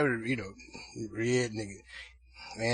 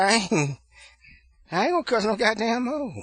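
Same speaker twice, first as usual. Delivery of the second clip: high, loud sung-out promise; singsong nephew; 11 semitones higher, 9 dB louder than usual. Retold or imitated. Not too bright nephew promising aunt, high mock singsong. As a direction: imitate the boy protesting at the top of his range. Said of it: "they all talk like they singing".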